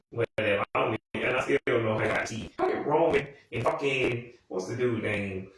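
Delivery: ordinary talk, nothing marked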